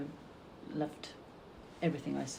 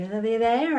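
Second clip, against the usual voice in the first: gran on the phone; 6 semitones higher, 11 dB louder, slower than usual. Retold or imitated. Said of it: "and she'd say".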